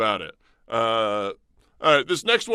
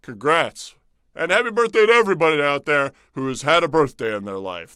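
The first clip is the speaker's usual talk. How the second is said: usual speaking voice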